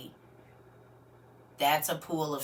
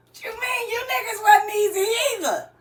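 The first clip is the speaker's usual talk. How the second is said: laughing tease; 6 dB louder than usual. Real, not acted. Host teasing viewers.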